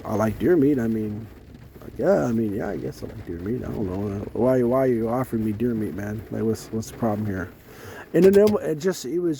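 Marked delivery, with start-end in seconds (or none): 0.0-7.5 low, halting, reluctant reply; wary hesitation. Retold or imitated. Guest answering the dude offering deer meat, wary and hesitant. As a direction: hedge in a low voice, full of pauses.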